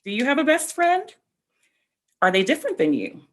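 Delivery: usual speaking voice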